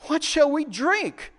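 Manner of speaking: high, drawn-out complaint; murmuring complaint. Acted. Thirsty people murmuring against Moses, high slow complaint. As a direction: grumble it slowly, pitched well up. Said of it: "the people murmured against Moses, saying".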